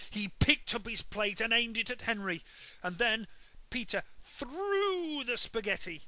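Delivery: building excitement. A narrator's reading. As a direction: build up to the throw. Climax of story.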